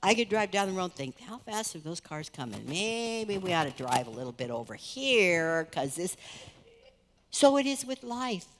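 usual speaking voice

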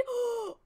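sharp high gasp of delight; excited gasp. Real, not acted. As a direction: gasp like great news just dropped. Hears new week came out.

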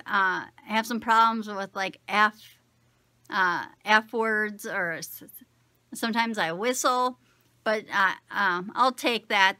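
with new false teeth; talking through full dentures; wearing dentures. Real, not acted.

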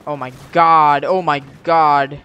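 loud, alarmed oh my god; real excited outburst. Real, not acted. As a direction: blurt it out in real alarm mid-fight. Frantic moment in a game fight.

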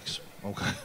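whispered, hesitant nervous okay. Retold or imitated. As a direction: breathe it out unsure and scared.

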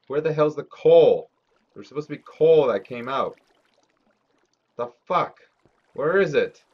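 loud, high annoyed complaint; exasperated. Real, not acted. Streamer can't find the coal, loud and exasperated. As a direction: demand answers from the game, fed up. Coal missing after mining.

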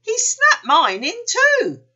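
very high, loud, slow wail; drawn-out high cry of dismay. A narrator's reading. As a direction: wail it like an upset child. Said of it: "cried Bumper".

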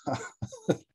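high burst of real laughter; short amused laugh, very high; sudden laugh. Real, not acted. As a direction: laugh at yourself. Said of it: "I'm laughing because that was me".

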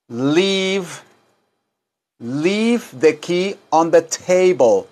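slow, careful English; slow teaching read; slow model sentence. Shown as a demonstration. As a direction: say it slowly for repeating.

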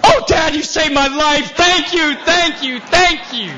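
high, loud gushing thanks; grateful child. Retold or imitated. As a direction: pour out thanks like a rescued kid. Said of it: "son grateful".

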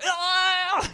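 high, loud mock shriek; excited fan shriek. People posing with Kato shriek, high and loud. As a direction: squeal like a thrilled fan. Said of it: "and going like".

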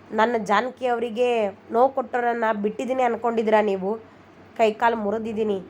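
ordinary delivery, nothing marked

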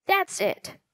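sudden high-pitched realisation; excited cry. A narrator's reading. Josh realises a clue to the ghost, excited cry. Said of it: "Josh cried".

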